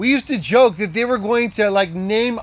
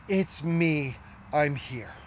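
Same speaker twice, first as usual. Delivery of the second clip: hushed comfort; 6 semitones lower, 9 dB quieter, slower than usual. Pretend. Voicing Jesus comforting.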